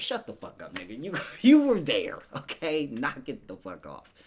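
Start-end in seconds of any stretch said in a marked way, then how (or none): 1.0-2.9 loud high laughing accusation; laughing callout. Real, not acted. Podcaster calling out lying celebrities, loud laughing. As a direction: call them out through laughter.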